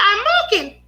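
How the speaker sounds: put-on high squeaky Mickey Mouse-like toddler voice; Justin as a little boy. Little son answers his mother about the pencil, in a high child voice. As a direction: mimic a small child's high-pitched lisped words. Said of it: "Justin used to sound like Mickey Mouse".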